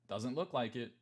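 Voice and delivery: normal talking voice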